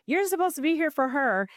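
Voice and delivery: retold indignant protest, pitched high; offended. Helper to the sleeping boyfriend, offended. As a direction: voice jumps up, acting out offence. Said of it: "I remember being so offended".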